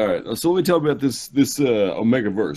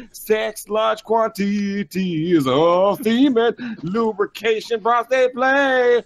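slow, high, theatrical recital; dramatic list reading; 4 semitones higher, slower than usual. Acted. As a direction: declaim each kink like a show. Reading kink list over music.

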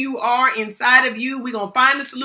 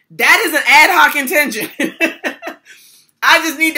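laughing; 4 semitones higher, 7 dB louder than usual. Real, not acted. Laughing at own grandson story.

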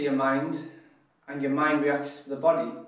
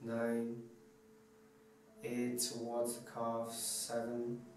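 hushed, unhurried guide into deep relaxation; guided meditation; 3 semitones lower, 13 dB quieter than usual. Acted. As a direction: talk them softly through a body scan, letting each phrase settle. Leads relaxation body scan.

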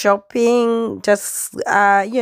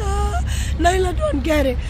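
a touch of a strained squeak; lost voice; 6 semitones higher than usual. Real, not acted. Mom complaining daughter doesn't get it, strained voice. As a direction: scrape the words out hoarsely. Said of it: "I don't have a voice".

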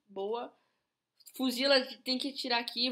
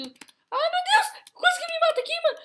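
high panicked yelp, nearly killed; panicked shriek; 4 dB louder than usual. Real, not acted. Quase morre no jogo. (grito de pânico)

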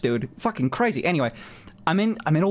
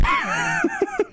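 short high laugh; laugh, amused. Real, not acted. Laughs at Zola joke.

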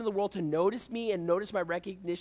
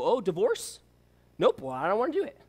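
louder, pitched-up mock brush-off; flippant refusal; 4 semitones higher, 5 dB louder than usual. Husband brushes off wife's request, mock flippant. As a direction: dismiss it breezily and loudly, play-acting.

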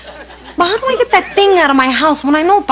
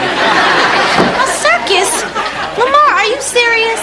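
slow, higher, disbelieving; incredulous; 8 semitones higher, slower than usual. Real, not acted. [name] disbelieving her director's circus idea, incredulous. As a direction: stretch it out in disbelief.

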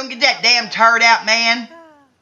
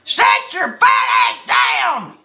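yelling at the screen; 12 semitones higher, slower than usual. Real, not acted. Yelling at game character.

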